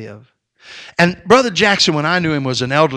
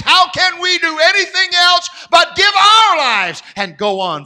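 high, loud, ringing pulpit cry; full-force preaching; 13 semitones higher, 11 dB louder than usual. Acted. Preacher exhorting his congregation, full-force shouting preaching. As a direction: preach it out at the top of your voice.

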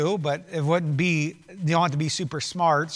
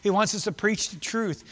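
usual speaking voice

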